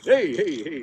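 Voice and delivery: loud, high, jumpy shout; acted fright at full volume; startled yelp. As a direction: yell as if touched from behind. Man startled by touch behind.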